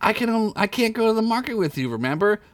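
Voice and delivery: tomboy squirrel voice. Acted. Squeak's voice.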